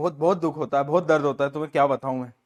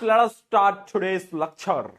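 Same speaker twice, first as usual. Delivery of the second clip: very slow, stretched-out lecture opening; comic drawn-out announcement; much slower than usual. Pretend. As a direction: drag every word out playfully. (मज़ाकिया, खींच-खींचकर धीमा ऐलान)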